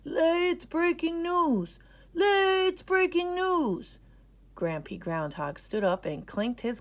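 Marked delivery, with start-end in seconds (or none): 0.0-4.0 put-on high, slow, loud old-timer call; grandpa's big announcement; announcing. A narrator's reading. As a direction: proclaim it like a town crier.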